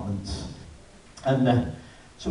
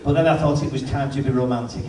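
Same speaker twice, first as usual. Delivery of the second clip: ordinary delivery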